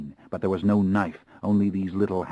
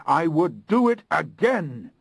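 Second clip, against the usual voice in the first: higher and louder, defiant; raised, ringing declaration; louder repetition; 11 semitones higher, 5 dB louder than usual. A narrator's reading. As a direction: shout the vow this time. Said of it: "my voice growing louder".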